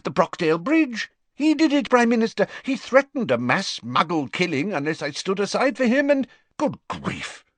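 high, urgent minister pleading his case; anxious wizard official, pitched high; Fudge's voice. Acted. Fudge tells the Prime Minister they're at war, high and urgent.

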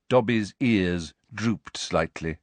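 ordinary delivery

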